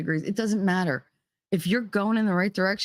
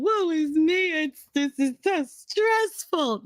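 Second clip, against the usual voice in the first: much higher, slow whining lament; mocking whiny victim; 12 semitones higher, slower than usual. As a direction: mimic a self-pitying complainer. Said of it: "walk around".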